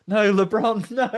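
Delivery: high, loud pretend panic; mock nightmare cry. Imagining someone's nightmare about tall men, mock panicked cry. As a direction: cry out as if dreaming, hammed-up. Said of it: "having a nightmare going".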